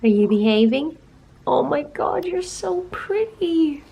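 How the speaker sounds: cooing to the dog, slow and high; pet talk. Real, not acted. Fussing over her dog, a cooing pet voice.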